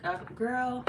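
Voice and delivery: put-on exasperated, high "girl" aimed at the screen; retold scream at the screen. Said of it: "I was just screaming to myself".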